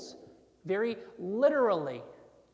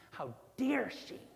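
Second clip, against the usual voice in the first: indignant disgust at her; mock outrage, voicing the offended Pharisee; 3 semitones higher than usual. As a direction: utter it as an affronted objection. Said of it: "He is placing this disgust".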